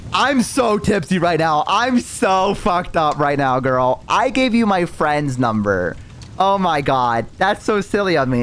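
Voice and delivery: put-on slow, drawn-out drunk excuse; feigned oops-silly-me, dragged out; playing tipsy. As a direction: stretch words out with hammed-up tipsy innocence. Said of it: "I'm a little tipsy".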